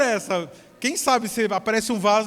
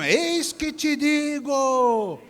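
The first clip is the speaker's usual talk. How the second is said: very slow, drawn-out prophetic pronouncement; slow solemn prophecy voice; Pentecostal prophet; 4 semitones higher, much slower than usual. Retold or imitated. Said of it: "aquele estilo pentecostal mesmo".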